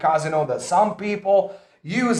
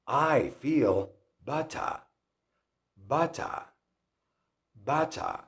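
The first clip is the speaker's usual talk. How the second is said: soft, slow British "better" with no final R; British non-rhotic "better", ending in an open vowel; British accent; 5 semitones lower, 10 dB quieter, slower than usual. Shown as a demonstration. Teacher showing learners the dropped R, British accent. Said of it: "They don't pronounce the R in the end".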